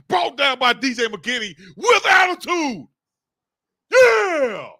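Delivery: slow, high emphatic call bursting into a yell; hyped play call. Real, not acted. Fan calling McKinney's tackle, hyped shouting. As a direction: punch out the tackle, then holler.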